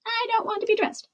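high, quick refusal; calling out. A narrator's reading. Princess refusing from under the bed, calling out. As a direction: call out a defiant high-pitched no. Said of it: "Isabella called out".

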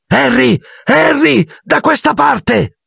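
high, bright call across a crowded street. A narrator's reading. Hermione calling Harry over from the bank steps, high and ringing.

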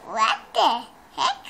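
loud laughing what the heck; laughing disbelief. Real, not acted. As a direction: burst out laughing in shock.

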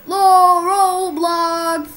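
very slow, stretched intro cry; hammed-up channel intro; drawn-out announcer call.

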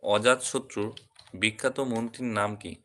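ordinary talk, nothing marked

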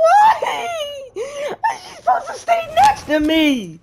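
high squealing laugh-cry, slowed. Real, not acted. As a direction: wail it through laughing despair. Exasperated at companion AI.

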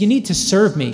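ordinary talk, nothing marked

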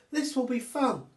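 little monkey thrilled to start climbing; excited little monkey. Acted.

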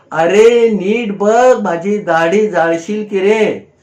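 loud, high, drawn-out shout of alarm; ओरडून. A narrator's reading. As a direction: yell a startled scolding warning. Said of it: "ओरडून म्हणाले". (ओरडून दटावणे, आवाज चढवून)

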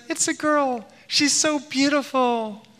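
mock-sweet high pitch. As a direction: coo it slowly, imitating a nurse gushing over a baby girl. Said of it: "gone up even higher".

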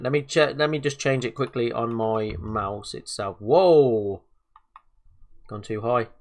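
usual speaking voice

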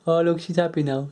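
usual speaking voice

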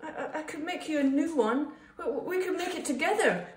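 high, stammering, eager plea; pleading. A narrator's reading. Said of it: "pleaded Bob".

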